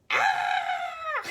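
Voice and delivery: high imitated baby goat yell; goat scream. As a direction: copy the fake dramatic scream, loud and high. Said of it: "fake, dramatic noise".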